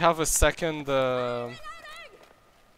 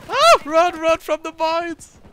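loud high panicked yell; man down shout; 14 dB louder, slower than usual. Real, not acted. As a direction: scream it out while fleeing. Fleeing own mines in firefight.

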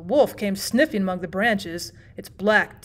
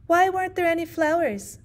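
put-on child's question; 7 semitones higher than usual. Child asks the storyteller.

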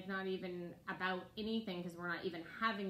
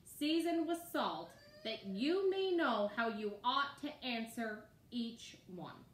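slow emphatic scripture; 3 semitones higher, slower than usual. Real, not acted.

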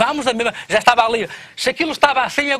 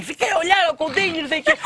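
laughing woman; 8 semitones higher than usual. Retold or imitated. Teller voices the woman.

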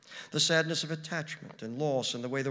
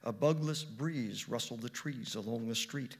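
ordinary delivery

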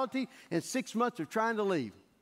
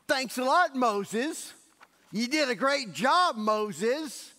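higher, louder mock gratitude; sarcastic thanks; 4 semitones higher, 4 dB louder, much slower than usual. The Hebrews turn on Moses, loud sarcastic thanks. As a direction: thank him bitterly.

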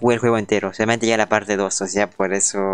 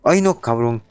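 normal talking voice

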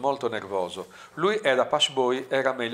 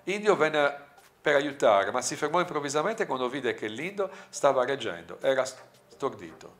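ordinary delivery

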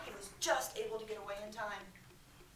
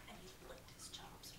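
whisper; 19 dB quieter than usual. A narrator's reading. Hushed storytelling line.

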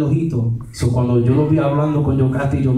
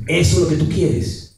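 ordinary talk

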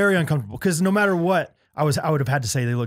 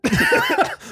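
real laugh; 3 dB louder than usual. Real, not acted. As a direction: burst of laughter. Laughing at own gaffe.

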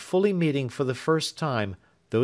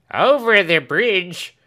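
loud slow call, higher; 8 semitones higher, 9 dB louder, slower than usual. A narrator's reading. Servant calls out that the coach crosses the bridge. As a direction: shout the news from afar. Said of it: "cried Britain".

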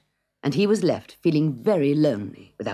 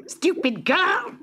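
very high, slow, scornful screech; angry witch snap; 4 dB louder, much slower than usual. Acted. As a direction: spit out the insult at the girl. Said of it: "snapped the witch".

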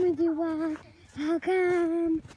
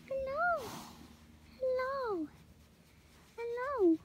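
high pet voice; 9 semitones higher, 6 dB quieter than usual. Real, not acted. Girl greets her cat in a high cooing voice. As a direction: coo softly up high at the pet.

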